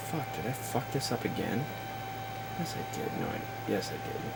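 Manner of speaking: barely audible self-scolding under the breath; muttered to self. Real, not acted. Muttering to himself over a glitch.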